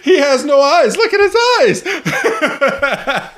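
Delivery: high-pitched, laughing squeal; mock hysterics. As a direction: imitate someone dying of laughter, voice way up. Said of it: "They're just dying".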